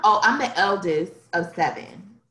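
ordinary talk

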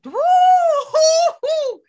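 loud, high whoop of approval; approving whoop. Real, not acted. Whoops at co-host's scripture quote.

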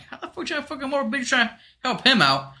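high-pitched indignant arguing; exaggerated high, exasperated comeback; retold incredulous retort. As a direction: fire back in disbelief, voice way up. Retold argument with the wife.

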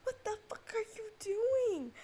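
high, loud exasperated screech; worked-up yelling, stretching the last words; outraged retold yell. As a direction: shriek it at someone barging in. Acts out yelling at intruder.

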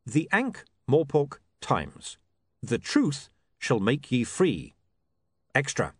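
slow, deliberate read-out; reading a masthead. Acted. William reading the new masthead, slow and deliberate. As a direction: spell out each headline phrase with weight.

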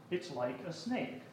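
a quiet small voice taking it in; Daniel the puppet. Acted. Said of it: "He said".

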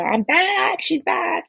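high, bright triumphant cheer; excited. Retold or imitated. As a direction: announce it like a comeback. Happily announcing her return.

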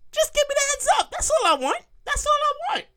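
upset customer begging just for a warning; high whiny plea. Real, not acted. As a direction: whine it like a wronged customer. Pleading for a heads up.

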